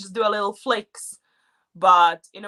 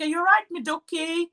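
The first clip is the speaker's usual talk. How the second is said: high, sing-song ducky greeting; sweet local endearment; 8 semitones higher than usual. Retold or imitated. Newcomer mimics the Mansfield greeting, high and sweet. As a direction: coo it at a friend. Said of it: "It's the same thing".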